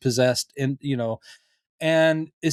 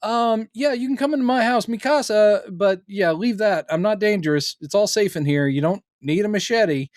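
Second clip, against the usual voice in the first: higher-pitched, placating reassurance; Carol; 6 semitones higher than usual. Retold or imitated. Host telling an armed guest it's safe, higher placating voice. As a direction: talk an uneasy guest down in a raised pitch. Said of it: "the whole thing of Carol going".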